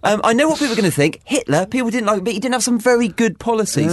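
high, upbeat corporate manager voice; a mock manager fishing for ideas; chirpy boss. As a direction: chair the meeting like an eager cheerful boss. Mock board meeting boss.

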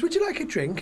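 high-pitched imitated hostess; offering wife. Wife offering visiting detective a drink, imitated hostess. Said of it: "she goes".

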